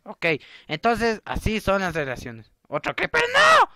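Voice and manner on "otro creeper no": high yell leaping into a shriek; piercing, playful horror; mock panic scream. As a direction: cry out in exaggerated alarm. Another creeper appears.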